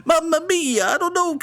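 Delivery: high hammed-up Italian accent, Mamma mia; a man dressing up, in a hammy Italian accent; mock Italian accent. Painter getting ready to go out, in a high mock Italian accent. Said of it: "we have to do the offensive accent here".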